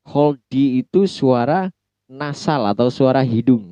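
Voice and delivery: normal talking voice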